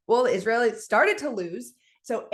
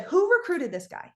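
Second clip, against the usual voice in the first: higher, mock-incredulous question; exasperated disbelief; 6 semitones higher than usual. Coach asking who recruited a terrible player, exasperated disbelief. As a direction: imitate an exasperated coach, pitched up.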